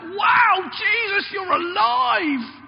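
high, loud, drawn-out cry of joy; mock joyful shout. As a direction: shout it out like a hammed-up miracle reaction. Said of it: "she shouted out".

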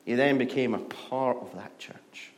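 ordinary talk, nothing marked

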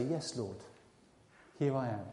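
soft, low response to God; quiet surrender; reverent answer. Acted. Preacher models a reverent answer.

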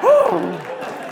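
loud high whoop of joy; a real cheer bursting out; woo. Real, not acted. Preacher cheering as he relives the healing. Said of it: "It was cool, man".

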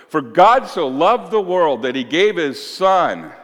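loud, high proclaiming of the verse; preaching scripture. Acted. Preacher proclaiming scripture to the congregation, forceful.

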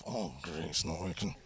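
very low, soft mutter; hushed low note of defeat; dejected aside. Acted. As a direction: mutter to yourself, pitch dropped right down. Father's aside as technique fails.

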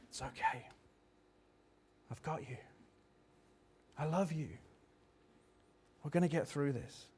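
put-on Father soothing a broken child; hushed and tender, with long pauses; comforting whisper. God comforting broken Elijah in whisper.

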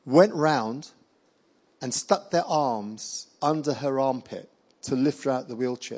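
normal talking voice

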